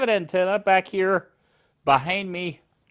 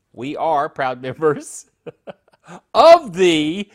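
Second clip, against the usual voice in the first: laughing; 4 semitones lower, 5 dB louder, slower than usual. Real, not acted. Laughs while stumbling over words.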